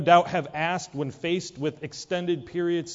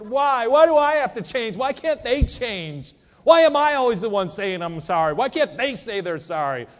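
loud indignant whining; protest, voice rising high; complaining outburst; 6 semitones higher, 6 dB louder than usual. Acted. Acted whiny complaint prayer.

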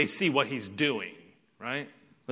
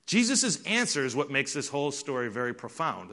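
normal talking voice

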